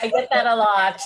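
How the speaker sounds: laughing through the words, amused. Real, not acted. Coach laughing at feedback she often gets. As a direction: chuckle it out, tickled by the comment. Amused reply to teasing question.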